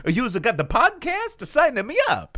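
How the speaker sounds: put-on high, loud eager Italian accent. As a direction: jump in eagerly, Mario-style Italian. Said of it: "I make a voice on my show".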